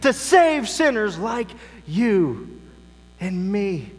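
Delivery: urgent pulpit proclamation; impassioned preaching. Real, not acted. Preaches at full force.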